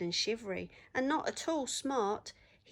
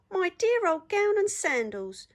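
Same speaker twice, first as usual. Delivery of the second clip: much higher, louder joyful shout; delighted yell; 10 semitones higher, 8 dB louder than usual. Acted. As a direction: yell with joy at finding it. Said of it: "he yelled".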